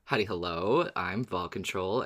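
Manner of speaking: ordinary delivery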